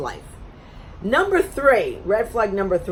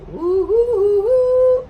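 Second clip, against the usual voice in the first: high, rising, louder siren woo; a wailing siren, much higher; mock alarm siren; 14 semitones higher, 6 dB louder, much slower than usual. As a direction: sound the red-flag alarm. Alarm at his anger confession.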